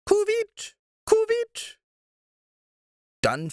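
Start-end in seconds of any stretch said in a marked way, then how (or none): 0.0-1.8 high, loud hooting owl call; owl cry. A narrator's reading. Owl crying where the witch vanished, high loud hoot. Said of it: "den Schrei einer Eule".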